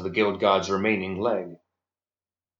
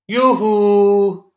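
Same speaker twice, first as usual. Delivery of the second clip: taunting call; 11 semitones higher, 7 dB louder than usual. Acted. Inmate taunting orderlies through bars.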